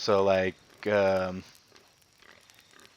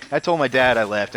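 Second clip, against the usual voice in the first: ordinary delivery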